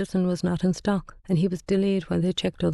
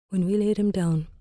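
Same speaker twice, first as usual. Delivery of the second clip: usual speaking voice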